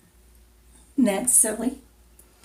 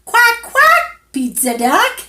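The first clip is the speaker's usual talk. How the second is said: high, louder duck quacks; playful quacking; 14 semitones higher, 9 dB louder than usual. Acted. To the child, answering the pizza duck, playful. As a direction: quack like a duck, then greet.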